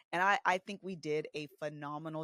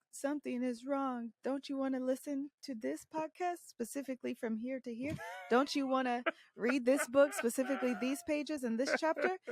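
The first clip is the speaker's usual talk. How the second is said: mock pushy wife; 9 semitones higher than usual. Wife pushing husband toward therapy.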